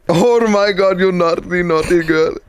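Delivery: hammed-up Indian accent, starting high; mock Indian accent. Imagined Indian man teasing his lover, mock Indian accent. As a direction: play up a comic Indian lover's voice. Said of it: "imagine you being an Indian man".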